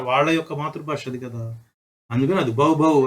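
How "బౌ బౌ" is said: puppy bark. Retold or imitated. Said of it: "అని అరవమంది". (పిల్లలకు కుక్కపిల్లలా సరదాగా బౌ బౌ)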